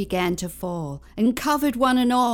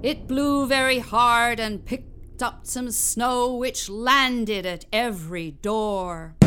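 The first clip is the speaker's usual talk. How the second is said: usual speaking voice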